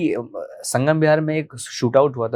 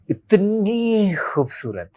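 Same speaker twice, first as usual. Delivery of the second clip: इतनी drawn out long and high, in wonder; awestruck admiration; 8 semitones higher, much slower than usual. Real, not acted. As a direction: stretch इतनी slowly upward, marvelling at her beauty. Gushing over first sight of girl. (इतनी को ऊँचे सुर में लंबा खींचकर, मुग्ध होकर)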